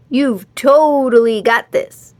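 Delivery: loud, slow cheering call; shouted encouragement. A narrator's reading. Dudley shouts encouragement to Henry, loud. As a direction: cheer him on from afar. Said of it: "Dudley shouted".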